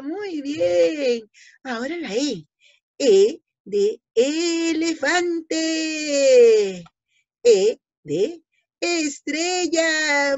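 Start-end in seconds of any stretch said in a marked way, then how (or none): 3.0-10.4 slow, drawn-out vowel drill; slow teacher drill. Shown as a demonstration. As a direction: stretch every word for the kids. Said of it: "teaching vowels slowly".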